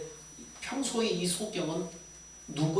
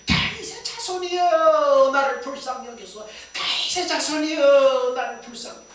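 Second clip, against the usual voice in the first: shouting even louder; 10 semitones higher, 10 dB louder than usual. Retold or imitated. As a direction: wail out the name, then plead for mercy. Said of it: "더욱 크게 소리 질러서 외치는 거예요".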